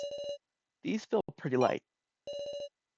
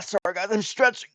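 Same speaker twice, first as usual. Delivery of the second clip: usual speaking voice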